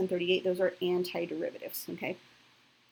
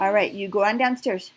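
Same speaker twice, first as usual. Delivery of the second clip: raised voice; 9 dB louder than usual. Real, not acted. Mom telling child to go.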